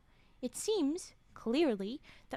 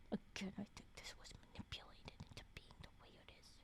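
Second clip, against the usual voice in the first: rushed, much softer unvoiced aside; fast whisper; 4 semitones lower, 18 dB quieter, much faster than usual. Real, not acted. Streamer whispering a theory to chat, fast whisper. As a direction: rattle it off under your breath.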